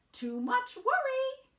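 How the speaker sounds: high, loud fussy granny; sing-song it like a fussing granny; grandmother voice. Acted. Grandmother scolding Wemberly, high and loud. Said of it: "said her grandmother".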